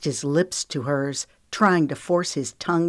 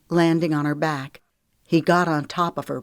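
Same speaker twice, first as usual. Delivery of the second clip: ordinary delivery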